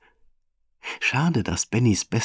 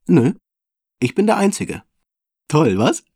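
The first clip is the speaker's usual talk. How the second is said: put-on high, louder boyish boast; proud child; 8 semitones higher, 6 dB louder than usual. Benny prahlt vor Mama, stolz und kindlich. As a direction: brag like a little kid.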